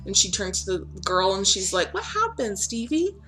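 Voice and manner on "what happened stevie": high, soft, worried question; gentle concern. Acted. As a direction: ask softly so the girl feels safe. Eliza asks the hurt kid's sister.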